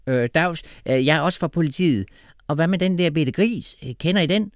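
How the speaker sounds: a hint of a hesitant female officer; policewoman. A narrator's reading. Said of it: "spurgte den anden betjent, der var en kvinde".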